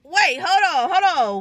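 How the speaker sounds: 흥분해서 외치듯 멈추기; stopping herself. Real, not acted. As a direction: call out to halt the story. Stops herself mid-story, calling out.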